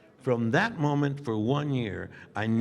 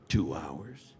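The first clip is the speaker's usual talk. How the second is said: normal talking voice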